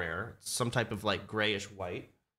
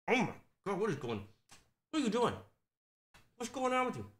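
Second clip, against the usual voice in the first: startled puppy talk; 9 semitones higher, faster than usual. Real, not acted. Puppy doing something odd.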